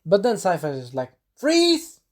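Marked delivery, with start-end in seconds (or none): 1.4-2.0 loud, high battle cry; shouted spell command. Acted. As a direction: shout it like casting an ice spell. Acting out sorceress casting ice spell.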